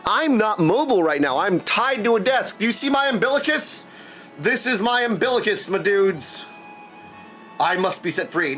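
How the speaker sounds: ordinary talk, nothing marked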